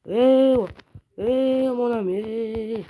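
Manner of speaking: high, loud, drawn-out call; agitated calling out. Real, not acted. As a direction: call out to keep someone back. Calling out to threatening player. (interpellation aiguë et agitée)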